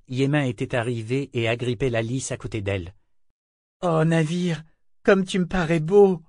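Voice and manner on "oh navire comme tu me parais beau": high, loud wonder; admiring exclamation. A narrator's reading. Man admires the ship beside Althea, admiring exclamation. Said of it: "s'exclama-t-il".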